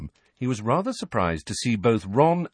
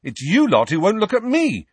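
angry, high and loud; 13 semitones higher, 7 dB louder than usual. A narrator's reading. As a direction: throw the blame back angrily. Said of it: "said Harry angrily".

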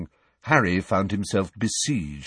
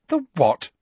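higher, quick and sharp; sharp question; 10 semitones higher, 3 dB louder than usual. Acted. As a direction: snap the question back. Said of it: "said Hermione sharply".

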